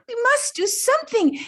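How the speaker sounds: high, eager little bird voice; small parrot rallying the creatures. A narrator's reading. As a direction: plead urgently as a tiny bird. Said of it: "But the little bird said".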